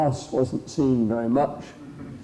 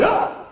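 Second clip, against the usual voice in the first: sudden very high yelp; wolf's startled cry; 4 dB louder than usual. Acted. Vacant wolf hears his name, yelping in sudden surprise. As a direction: jolt awake with a short, high "Ah".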